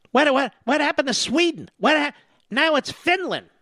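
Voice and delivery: ordinary talk, nothing marked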